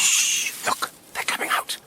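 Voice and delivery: sharp whispered shush then hushed warning; urgent hush. Acted. Conquest silencing friends in the bushes, urgent whisper. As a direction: hiss the others silent. Hiding, crooks emerge.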